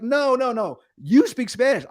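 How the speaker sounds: high-pitched, emphatic mock protest; insistent arguing. Son arguing with his dad in front of the agent, insistent.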